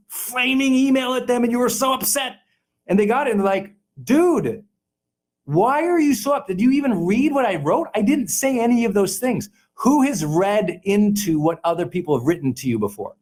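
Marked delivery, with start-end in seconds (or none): 0.1-2.4 mock outrage. Mimicking an upset flaming email. 4.0-9.5 higher-pitched mock exasperation; exasperated protest. Email recipient answering an upset sender, exasperated.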